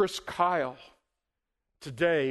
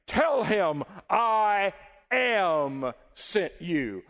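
loud, very slow divine proclamation; huge drawn-out preacher's thunder; 4 semitones higher, 4 dB louder, much slower than usual. Acted. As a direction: boom I AM as God from the burning bush. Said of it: "a voice thundered from the bush".

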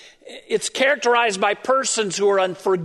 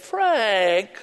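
condescending. Retold or imitated. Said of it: "in a very condescending tone".

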